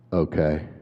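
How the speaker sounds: put-on low, flat child reply; lightly voiced bored kid; flat unimpressed okay. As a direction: say it like you're not impressed. Kid answers flatly, unimpressed.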